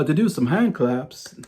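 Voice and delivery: slow, attempted Liverpool accent; drawn-out amateur Scouse impression. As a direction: imitate a Liverpudlian frontman rallying the audience. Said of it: "if I can do my Liverpool accent".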